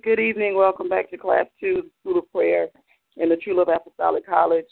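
ordinary talk, nothing marked

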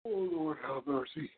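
words stretched through a yawn. Real, not acted. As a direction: talk mid-yawn, mouth wide. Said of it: "I'm just yawning".

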